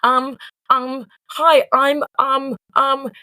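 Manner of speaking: high, hesitant nervous stammering; lying to a boss on the phone, flustered and stammering. Retold or imitated. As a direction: panic on the phone, stumbling over every word. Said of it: "And I was like".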